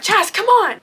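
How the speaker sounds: retold urgent shout; urgent call. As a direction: call out to hurry a friend along. Friend urging her to flee gunfire.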